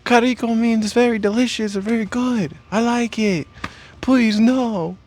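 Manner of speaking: put-on high-pitched girl voice; girl's voice. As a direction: squeak it up like a flustered girl. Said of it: "she's like".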